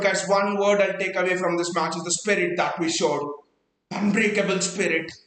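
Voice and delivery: ordinary delivery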